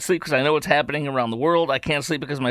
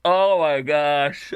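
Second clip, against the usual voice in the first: usual speaking voice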